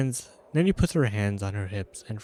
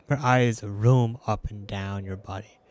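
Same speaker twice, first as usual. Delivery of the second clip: ordinary delivery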